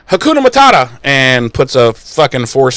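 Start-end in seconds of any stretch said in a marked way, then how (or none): 0.1-1.0 high, quick mock incantation; high-pitched playful spell call; casting a spell. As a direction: sing out the magic words high. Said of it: "but she goes".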